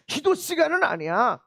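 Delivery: ordinary delivery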